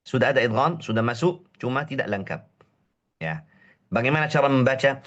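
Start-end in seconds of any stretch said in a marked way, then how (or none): none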